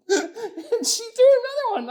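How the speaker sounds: can barely get it out for laughing. Real, not acted. Laughing at pea-throwing date.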